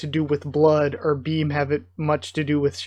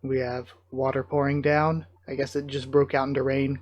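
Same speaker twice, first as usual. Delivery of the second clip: usual speaking voice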